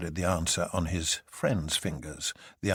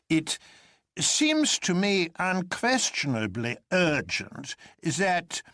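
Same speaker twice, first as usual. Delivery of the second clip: higher, slow, carefully measured professor voice; professor's elaborate calm; 6 semitones higher, slower than usual. A narrator's reading. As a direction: keep a studied, deliberate calm. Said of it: "with the same elaborate calm".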